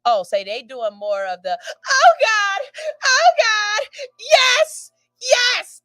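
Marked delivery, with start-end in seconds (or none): 1.9-5.8 high, loud, hammed-up moaning; mock moaning. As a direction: overdo the neighbors' moans, much higher and louder. Neighbors' sex sounds acted out.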